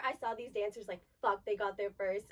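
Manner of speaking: ordinary talk, nothing marked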